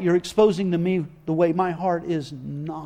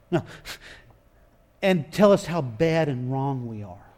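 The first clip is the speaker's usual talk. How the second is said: ordinary talk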